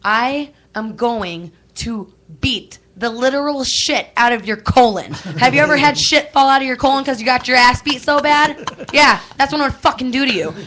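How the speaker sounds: hammed-up menacing rant; retold threat. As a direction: lay into him like you mean it, half joking. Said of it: "I literally turned around and I was like".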